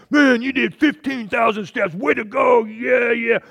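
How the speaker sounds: loud, high-pitched cheerleader shout; hammed-up cheering. Phone app congratulating a man on his steps, hammed-up cheering. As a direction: cheer wildly as an overexcited app.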